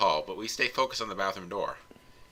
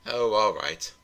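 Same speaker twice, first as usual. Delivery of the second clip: ordinary delivery, nothing marked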